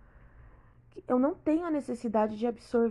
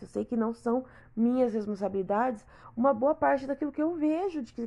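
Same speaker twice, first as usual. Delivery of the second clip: normal talking voice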